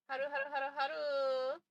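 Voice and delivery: ordinary delivery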